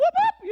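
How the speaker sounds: very high, loud whoop; imitated war whoop. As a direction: let out a sharp battle whoop. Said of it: "the deafening whoop".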